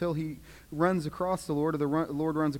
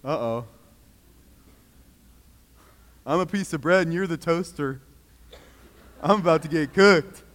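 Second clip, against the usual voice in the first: comic dread; 7 dB louder than usual. Acted. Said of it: "What do you think Saul's thinking".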